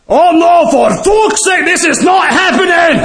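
high, raging mock shouts; furious Scot. Scottish drunk yelling at the fly to spit out his beer, high furious shouts. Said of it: "The guy from Scotland is pissed".

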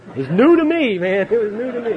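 loud, high-pitched defensive outburst; comic protest. Real, not acted. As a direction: protest loudly over the laughter. Comic self-defence over laughter.

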